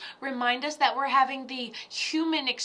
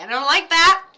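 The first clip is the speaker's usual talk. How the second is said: I don't like that shouted loud and firm; mock telling off; 5 semitones higher, 11 dB louder than usual. Telling the spirit off out loud, shouted firmly. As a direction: shout it at him like you mean it. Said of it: "go up there and be like".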